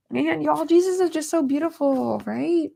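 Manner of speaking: high, tear-strained voice; crying. Real, not acted. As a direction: let the tears pull your voice up. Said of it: "I did not mean to cry".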